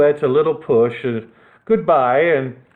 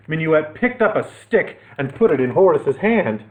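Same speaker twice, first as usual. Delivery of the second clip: usual speaking voice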